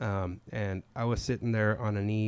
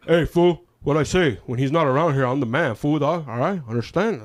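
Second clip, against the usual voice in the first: much louder bossy bark; tough-girl yell; 4 semitones higher, 12 dB louder than usual. Retold or imitated. Coworker loudly asserting she's the man, much louder. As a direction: bark orders like the boss.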